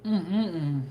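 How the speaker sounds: savoring food while eating. Real, not acted. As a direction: mouth full, tasting something delicious. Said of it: "This is so good".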